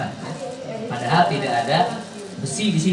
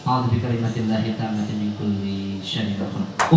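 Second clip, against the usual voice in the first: recited prayer; 5 semitones lower, 4 dB quieter, slower than usual. Acted. Healer recites protective prayer.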